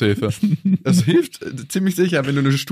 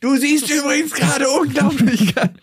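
mock shouted compliment; 14 semitones higher than usual. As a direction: bellow it across the room as a joke. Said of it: "Du brauchst es jetzt nicht rausschreien".

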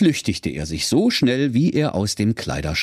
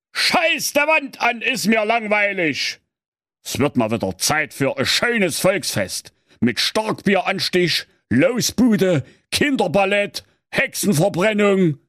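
grumbling king; 9 semitones higher than usual. Acted. Bored king grumbling to himself about a festival, high whiny voice. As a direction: moan from the throne out of boredom. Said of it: "motzte vor sich hin".

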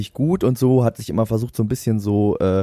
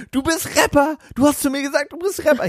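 squeaky high whiny imitation of a bragging stranger; mocking high voice of the drunk guy; 14 semitones higher than usual. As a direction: mimic him in a high, petulant squeal. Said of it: "Hat er gesagt".

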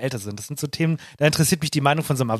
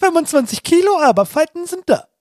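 mock squeal; 14 semitones higher, 5 dB louder than usual. Teasing friend about weight loss.

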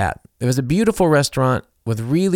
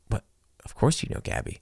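whispered aside; hushed; 9 dB quieter than usual. Retold or imitated. German teen whispering to the narrator. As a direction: lean in and whisper it.

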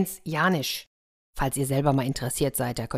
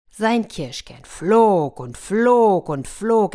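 slow, loud and higher, stretching each flog; drawn-out narration; 4 semitones higher, 6 dB louder, much slower than usual. A narrator's reading. Narrator tells children of the endless spit, slow and loud. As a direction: stretch it out like the pit flying forever.

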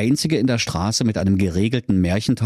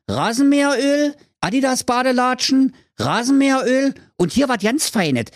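Höke's sales pitch; 13 semitones higher than usual. Acted. Höke preist Waren an.

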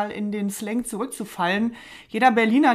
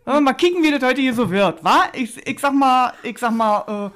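loud, hammed-up Berlin slang attempt; a clumsy try at Berlin street dialect, louder; 3 semitones higher, 8 dB louder than usual. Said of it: "das war mein Versuch, noch mal ins Berlinerische zurückzukehren".

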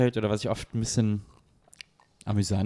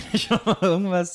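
lachend; 6 semitones higher than usual. Real, not acted. Wiederholt lachend.

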